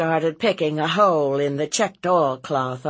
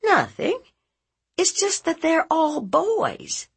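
very high-pitched explanation; exasperated aunt. Acted. Aunt answering about nephew's friends, exasperated and high. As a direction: point out the obvious from high in the voice.

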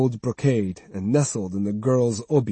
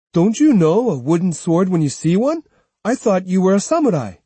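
put-on raised, sassy young boy; a kid talking back; cheeky boy voice; 7 semitones higher, 4 dB louder than usual. A narrator's reading. Jotaro retorts to samurai.

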